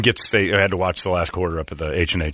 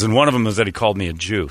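ordinary talk

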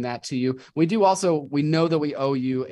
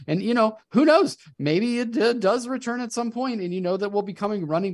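excited; 10 semitones higher than usual. Real, not acted. Hopeful excited pitch jump.

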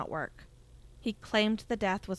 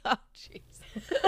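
laughing. Real, not acted. Laughing at own mistake.

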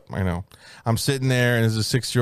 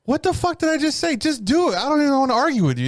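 high, fast, fed-up outburst; exasperated outburst; 14 semitones higher than usual. Retold or imitated. Fed up with the fussy barber, high exasperated outburst. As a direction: blurt it out, totally exasperated.

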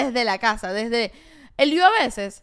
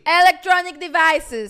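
slow, loud and higher commanding call; imitating security officer; 5 semitones higher, 6 dB louder, slower than usual. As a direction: drag out the order like an impatient guard. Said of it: "cuando me empiezan a hablar así". (imitando al guardia, lento y fuerte)

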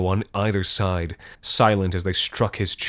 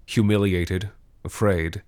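usual speaking voice

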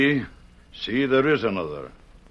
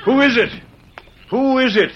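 higher, louder call to someone outside the gate; 12 semitones higher, 6 dB louder than usual. Acted. Padre calling out to the gate.

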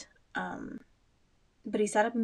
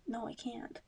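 ordinary delivery

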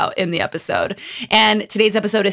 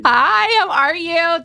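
excited greeting; 8 semitones higher, slower than usual. Real, not acted.

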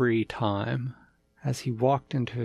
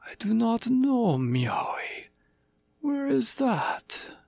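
tiger king voice; 11 semitones higher, slower than usual. Acted.

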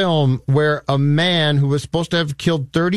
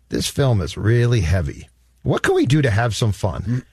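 a much lower, light mock voice of a producer; imitating a studio exec; 8 semitones lower than usual. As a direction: play the bored producer looking for fun, pitched well down. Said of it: "it's almost like somebody said".

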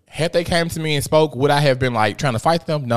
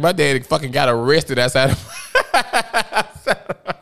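laughing while telling. Real, not acted. Laughing remembering dad's arrest.

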